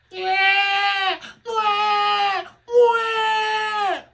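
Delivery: imitating the baby's cries; baby crying out. Father imitates baby waking during intimacy, high wails.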